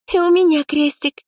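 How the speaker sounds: put-on high, gleeful girl's exclamation; merry. A narrator's reading. Friend finds she drew a cross too, merry. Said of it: "рассмеялась Аллочка".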